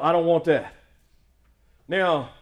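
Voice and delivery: usual speaking voice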